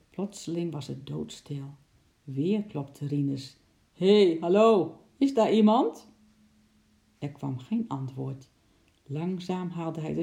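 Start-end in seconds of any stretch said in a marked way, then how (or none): 3.9-6.1 loud, raised call into the unknown. A narrator's reading. Mover knocks on the van door, calling out loudly. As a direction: call out loudly to whoever is hidden.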